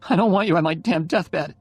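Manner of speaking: put-on dying girl angrily sends Oliver away; angry. A narrator's reading. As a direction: fling him out in a burst of anger. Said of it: "she said angrily".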